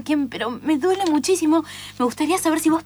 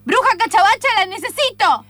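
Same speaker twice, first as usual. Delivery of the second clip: loud, higher summons; calling out; 5 semitones higher, 4 dB louder than usual. Acted. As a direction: call the witch from afar. Calling the witch to come. (llamando a la bruja en voz alta)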